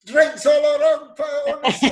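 mock shout to the whole bar; hammed-up jubilant holler; celebratory call-out. Acts old man celebrating in pub.

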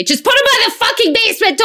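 exasperated yell. Retold or imitated. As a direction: snap at the son about his socks. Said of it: "no matter how many times I say".

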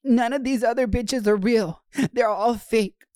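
high mock whine of a needy lover; mock crying boyfriend. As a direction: imitate a clingy boyfriend begging his man. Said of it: "crying to his top".

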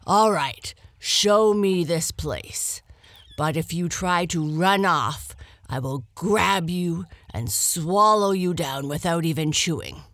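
loud, menacing lion warning; threatening lion. Acted. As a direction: warn the hare you'll swallow her whole.

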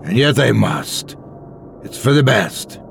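put-on mildly stern old man; stern insistence. A narrator's reading. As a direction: insist with understated firmness. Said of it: "Thomas insisted sternly".

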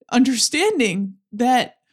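normal talking voice

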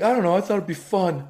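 put-on high young boy voice; kid voice. Son answering dad, innocent kid voice. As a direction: innocent eight-year-old. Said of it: "He's like".

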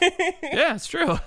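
high, put-on voice for a sheepish yes; mock agreeing. Telling his wife she's right, in a high mock voice. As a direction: squeak out a bashful admission. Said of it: "And I was like".

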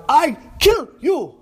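hammed-up rage, nouns and verbs only; broken-English screaming. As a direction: scream it like you can't finish a sentence.

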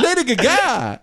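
put-on high old man's cry of [name]; [name]. Comic showing how the singer says the name, high cry. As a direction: exclaim it high, syllables run together. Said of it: "I swear to God, that's how he says it".

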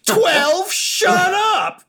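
put-on loud, very high-pitched kid's retort; indignant twelve-year-old. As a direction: squeal it back like an offended child wrestler. Acts twelve-year-old wrestler snapping back.

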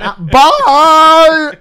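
high, loud sing-out goodbye; hammed-up bye, stretched out high; mock cheery farewell. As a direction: imitate a gushing visitor leaving. Acting out visitors' cheery goodbye.

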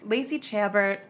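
ordinary delivery

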